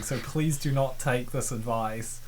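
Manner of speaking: ordinary talk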